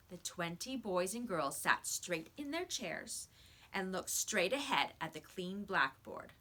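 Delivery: ordinary delivery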